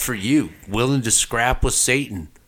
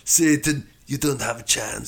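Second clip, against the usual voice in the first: mock Arnold taunt; 2 semitones higher than usual. Acting out Arnold fighting Satan.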